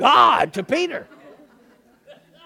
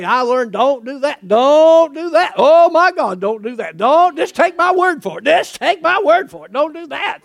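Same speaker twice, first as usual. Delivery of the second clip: higher, louder, urgent begging voice; pleading warning; 5 semitones higher, 8 dB louder than usual. Retold or imitated. Believer begging others not to repeat his mistake, urgent plea. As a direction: plead with them like you learned the hard way.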